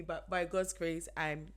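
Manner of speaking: ordinary delivery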